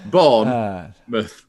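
Bournemouth drawn out very slowly, Yorkshire style; Yorkshire accent. Shown as a demonstration. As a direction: stretch Bournemouth, long Yorkshire vowels. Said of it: "You have to do it in a Yorkshire accent".

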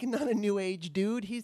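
normal talking voice

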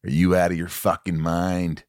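low, measured menace; Jack Nicholson. Acted. Host as The Shining husband answering wife, low Nicholson voice. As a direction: answer her low, like the movie star. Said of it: "I do a little Nicholson too".

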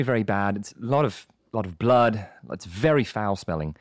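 low, measured British doctor; London accent. Acted. Doctor calling a school about a patient, low London accent. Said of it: "So that was a London accent".

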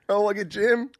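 high-pitched imitation of teasing relatives; mock teasing. Playing Jim's relatives giving it to him, mock teasing. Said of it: "they just give it to you".

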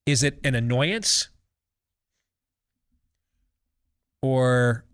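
talking while holding back a sneeze; fighting off a sneeze between words. Real, not acted. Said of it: "I've been fighting a sneeze here".

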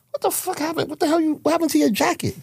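high-pitched incredulous questioning, rushing at the end; mock-baffled, voice shooting way up; retold disbelief. Retells own baffled reaction to jacket.

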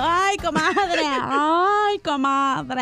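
slow, whiny mock sob on each word; mock crying. Coworker Chela crying in the break room, slow mock sob. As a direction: drag out a fake weepy lament. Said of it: "estaba llorando".

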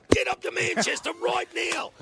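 high, loud shouted order down the phone; retold manager's shout. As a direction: bark it like an urgent manager. Team manager's urgent call.

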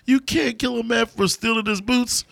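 high mock villain line delivery; quoting a villain. Podcaster quoting the villain's line to his co-host. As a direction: ham up the menacing movie quote.

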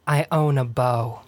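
low and slow, like a villain; Viper energy. Shown as a demonstration. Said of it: "like, Viper energy".

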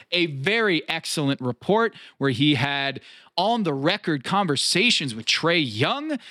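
ordinary talk, nothing marked

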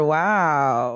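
ordinary delivery

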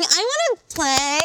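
high, drawn-out mock plea; whiny wife. Co-host mimicking a football-clueless wife who wants in, whiny wife. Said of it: "but she's like".

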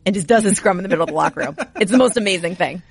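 ordinary talk

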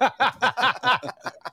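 high, rolling laugh. Real, not acted. Host teasing Greg about the flop, laughing. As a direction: laugh heartily in a long run.

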